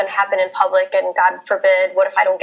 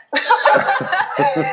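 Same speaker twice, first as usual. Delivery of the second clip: rire gêné aigu, voix soufflée; embarrassed high laugh; 10 semitones higher than usual. Real, not acted.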